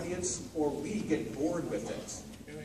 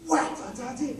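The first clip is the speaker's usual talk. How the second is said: sung blare, higher and louder; mock loud playing; 9 semitones higher, 4 dB louder than usual. Director mimics the students playing too loud, hammed-up sung blare. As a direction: imitate the band blasting forte. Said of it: "I heard".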